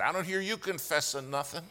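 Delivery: high, loud accusing yell; retold yell. Prayer partner scolding the silent lawyer, retold yell. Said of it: "he yelled at me".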